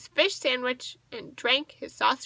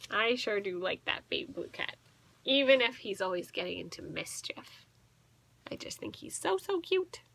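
soft whispered aside; fond whisper; 2 semitones lower, 6 dB quieter than usual. Real, not acted. To the listening child, a fond soft whisper. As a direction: gush quietly about the cat.

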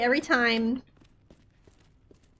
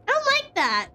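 high frightened yelp, much higher; scared squeal; 11 semitones higher than usual. Real, not acted. Scared by game, repeats line.

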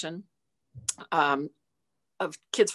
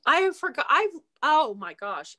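usual speaking voice

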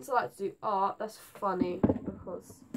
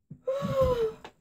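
sharp high gasp of fright; gasp, genuinely startled; real frightened gasp; 4 dB louder than usual. Real, not acted. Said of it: "That just scared the hell out of me".

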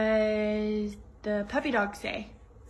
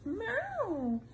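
cat meow; 10 semitones higher than usual. Acted. Mother imitates cat.

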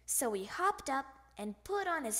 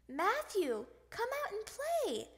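calling out; 9 semitones higher than usual. A narrator's reading. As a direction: call a friend out to play. Calling friend at his house.

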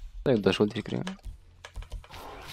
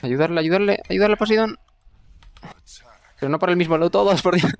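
shouting; 10 semitones higher, 5 dB louder than usual. Real, not acted. Calling teammates to help Poseidon.

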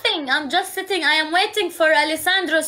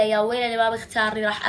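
mock threat; 6 semitones lower, 3 dB quieter, much faster than usual. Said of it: "استخدمت الفلتر".

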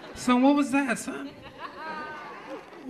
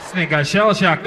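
usual speaking voice

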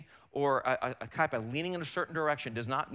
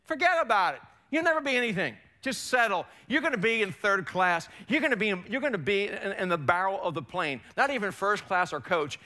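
high, raised-voice taunting put-down; preacher acting the discouraging enemy, pitched up; enemy's accusing voice; 4 semitones higher than usual. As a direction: belittle them like an accuser in their head. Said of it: "That's what the enemy will tell you".

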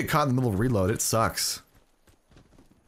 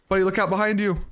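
normal talking voice

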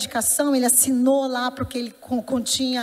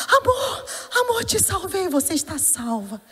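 high cry that falls away; imitating a husband's overwrought relief, starting high; mildly frantic retold relief; 7 semitones higher than usual. Deluded husband telling his wife he saved her, retold frantic relief.

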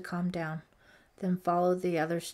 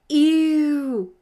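1 disgusted ew; 8 semitones higher, 12 dB louder than usual. A narrator's reading. Girl reacts to bat.

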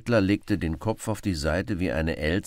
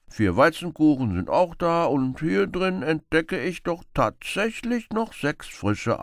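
loud, relishing list of food; proud beggar; 3 semitones higher, 4 dB louder than usual. Acted. Beggar shows Robin his food, loud and relishing. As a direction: savour each dish as you show it.

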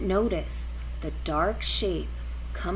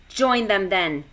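loud snarling lobster; lobster voice with claw clacks; 8 dB louder than usual. A narrator's reading. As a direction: snarl it dismissively. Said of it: "snarled the lobster".

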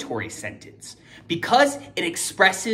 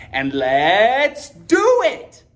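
excited; 3 semitones higher, 5 dB louder, much slower than usual. Acted. Energetic teacher kickoff.

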